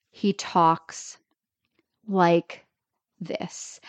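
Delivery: very slow, long pauses between words; halting speech pattern. Retold or imitated. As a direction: imitate a stilted actor, trailing into breath. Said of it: "it's his speech pattern".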